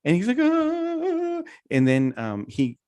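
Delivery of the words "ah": high, drawn-out kid's scream, imitated; mock panicked wail. Father imitates his son freaking out over an ant, high wail. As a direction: wail like a frightened little boy. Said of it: "you're freaking out over an ant".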